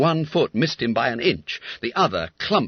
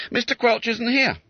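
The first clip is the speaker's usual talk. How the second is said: high, brisk porter; porter's voice; 14 semitones higher, 4 dB louder than usual. Acted. Porter telling the constable to wait, high and brisk. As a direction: servant answering the door politely.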